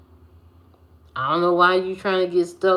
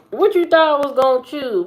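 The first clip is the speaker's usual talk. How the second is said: higher, louder and faster jab; mock teasing; 9 semitones higher, 7 dB louder, faster than usual. Teasing friend about food choice, higher faster mock jab. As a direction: fire it up high and quick to tease.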